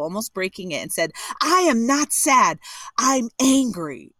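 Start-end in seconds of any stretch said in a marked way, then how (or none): 1.4-4.1 slow, louder and higher, biting off each word; retold mother insisting she is angry, not sad; angry denial. Son retelling his mother at the restaurant, slow angry insistence. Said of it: "she slammed her glass down on the table".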